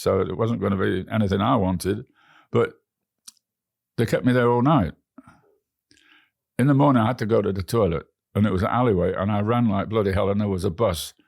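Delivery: plain reading voice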